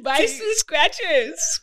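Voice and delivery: slow, laughing shop clerk line; laughing mock shopkeeper. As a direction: giggle through a clerk's sales pitch.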